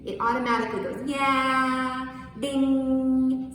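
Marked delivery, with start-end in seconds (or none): 1.1-3.5 "yeah, ding" raised and ringing; bell-like tone. Shown as a demonstration. Teacher likening me'ek to a bell: ringing "yeah, ding". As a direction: ring it out like a bell. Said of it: "Sounds like a bell dinging".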